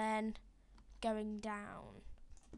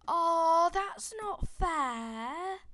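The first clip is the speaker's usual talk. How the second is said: whiny complaint; 7 semitones higher, 11 dB louder, much slower than usual. Real, not acted. Sapling won't drop, frustrated.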